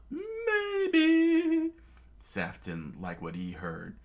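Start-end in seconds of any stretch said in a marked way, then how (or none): 0.1-1.7 very high, loud crying maybe. Acted. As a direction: wail the answer. Sanji answers calmly.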